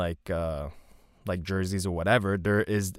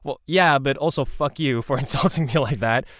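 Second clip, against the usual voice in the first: loud, raised mock protest breaking into a laugh; mock indignant outburst; 5 semitones higher, 4 dB louder than usual. Friend Matt answering the Irish stag party, loud mock outrage. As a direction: fire back in mock offence, laughing. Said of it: "And Matt was like".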